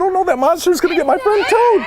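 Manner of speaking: high, rushed worry for a friend; storybook panic, tumbling out fast; worried. A narrator's reading. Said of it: "Frog was so worried about his friend Toad".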